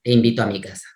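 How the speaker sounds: mock flat low reading. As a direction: mimic the flat deep voice of a student. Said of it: "la frase decía".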